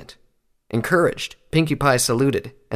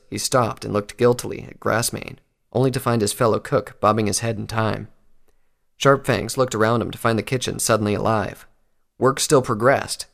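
ordinary delivery, nothing marked

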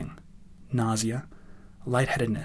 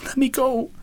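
high, desperate cry; high-pitched struggling plea; 14 semitones higher than usual. A narrator's reading. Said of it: "crying out".

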